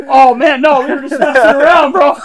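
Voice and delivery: high, loud mock protest; retold panicky backpedal, full volume; alarmed denial. Prankster explains to head of security, loudly protesting.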